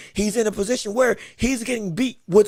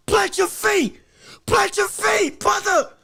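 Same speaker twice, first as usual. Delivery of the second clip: 1 shouted instruction; 8 semitones higher, slower than usual. Real, not acted.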